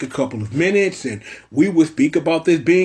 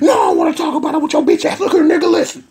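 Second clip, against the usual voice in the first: much higher, fast shouted rant; furious gangster yell; 11 semitones higher, 4 dB louder, faster than usual. Acted. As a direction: explode at him with a laughing yell. Gangster yelling at Me Tan.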